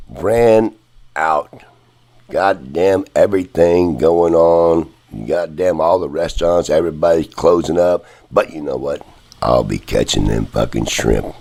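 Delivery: ordinary talk, nothing marked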